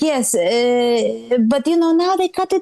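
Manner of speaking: put-on shrill scolding mother with heavy accent; Eastern European mom. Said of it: "That's the accent".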